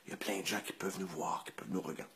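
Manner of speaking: hushed, urgent whisper; hissed warning in a hospital room. Retold or imitated. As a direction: whisper so nobody hears. Telling the patient to stop, whispered.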